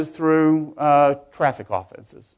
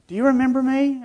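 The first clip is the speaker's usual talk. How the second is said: clerk's voice; 11 semitones higher than usual. Retold or imitated. Store clerk asks the judge.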